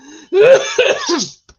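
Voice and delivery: sharp, high coughs; real coughing fit. Real, not acted. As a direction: cough hard after swallowing wrong. Coughing fit, swallowed wrong.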